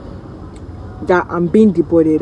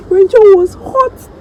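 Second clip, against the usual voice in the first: mock dramatic emphasis; 13 semitones higher, 4 dB louder, much slower than usual. Real, not acted. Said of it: "self emphasis".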